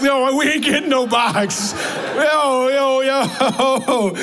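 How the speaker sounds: high-pitched comic objection; mock indignant protest. Preacher reacting to wife's look about the box, mock indignant. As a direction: pitch it up and protest, half laughing.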